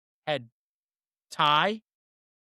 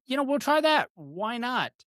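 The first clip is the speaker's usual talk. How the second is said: much higher, breezy shrug of a voice; carefree tourist; 8 semitones higher than usual. Retold or imitated. Friends pointing at a Thai menu, mock-casual high voice. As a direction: toss it off like a clueless tourist ordering blind. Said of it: "menu, going".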